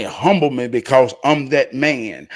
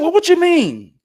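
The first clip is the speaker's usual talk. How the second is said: high, loud demand; loud question; 9 dB louder than usual. Retold or imitated. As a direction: fire it back high and loud. Pressing Junebug again.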